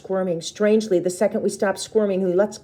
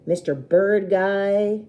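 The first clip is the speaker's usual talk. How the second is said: sneer; 3 semitones higher, 4 dB louder, much slower than usual. A narrator's reading. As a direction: draw out the mockery. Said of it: "he sneers".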